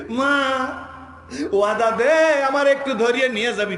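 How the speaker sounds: high, loud begging shout; urgent plea. Retold or imitated. Preacher as child begging mother for a promise, loud plea. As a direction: plead with mother at full voice.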